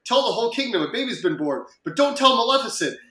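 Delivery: a hint of a royal announcing on the phone; King Stefan. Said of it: "This is King Stefan".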